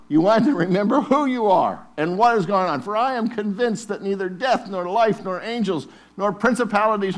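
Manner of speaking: loud, high, repeated "it is written"; preaching at full force. Acted. Preacher urging the church to wield scripture, full force. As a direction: chant it like a battle cry.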